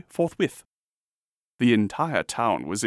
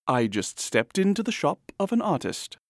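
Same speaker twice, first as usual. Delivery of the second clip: ordinary delivery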